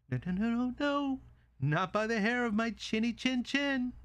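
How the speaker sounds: high-pitched little pig voice; defiant little pig. Acted. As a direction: squeal out the refusal as a tiny pig. Pig refuses the wolf.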